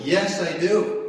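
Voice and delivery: slow, loud, forceful shout; a slow defiant shout of belief; forceful yell. Retold or imitated. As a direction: yell it back with total conviction. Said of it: "I yelled back forcefully".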